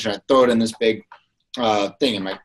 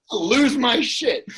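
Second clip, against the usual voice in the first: high, slow exasperated voice; fuming; 12 semitones higher, much slower than usual. Retold or imitated. Driver fuming at the beggar, high and slow. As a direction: drag it out, about to snap. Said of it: "I'm like".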